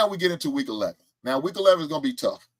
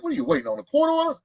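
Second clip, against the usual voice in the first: sarcastic; 12 semitones higher, faster than usual. Real, not acted. Sarcastic question.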